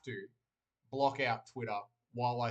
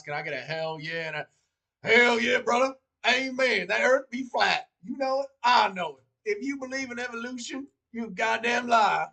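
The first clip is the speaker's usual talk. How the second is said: put-on southern accent; 9 semitones higher, 8 dB louder than usual. Streamer hamming a Southern voice for chat, loud and high. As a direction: whoop it up like a Southern good ol' boy. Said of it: "Jamie, you don't like my southern?".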